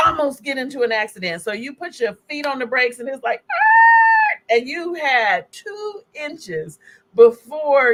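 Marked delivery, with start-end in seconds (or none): 3.5-4.4 sudden high loud scream of alarm; startled scream. Pretend. Speaker acting out a near car accident, screaming in alarm. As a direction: scream like you just slammed the brakes.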